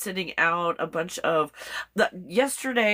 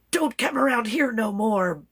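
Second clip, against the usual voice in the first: higher mock-threatening dog voice; imitated warning dog; 4 semitones higher than usual. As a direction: play a dog warning passers-by away. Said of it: "she didn't do the".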